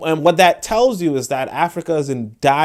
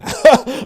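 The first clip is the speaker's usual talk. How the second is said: short laugh; 10 semitones higher, 3 dB louder than usual. Real, not acted. Reacts to absurd price.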